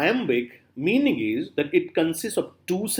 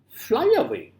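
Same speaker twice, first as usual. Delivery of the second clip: "fly away" with a high, strong first beat on FLY; stress on FLY; 8 semitones higher than usual. Shown as a demonstration. Teacher repeating a dactyl example, stress on FLY. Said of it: "first is, uh, is stressed".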